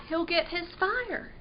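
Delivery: ordinary delivery, nothing marked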